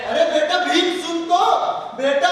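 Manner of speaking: calling after someone. Acted. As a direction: call out to a son walking off. Father calls after departing son.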